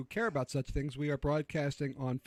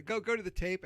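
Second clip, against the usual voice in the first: ordinary delivery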